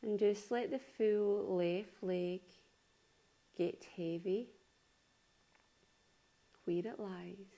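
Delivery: unhurried yoga nidra voice, long pauses between phrases; calm body-scan delivery, spaced out phrase by phrase; guided meditation. Acted. Leads a guided relaxation.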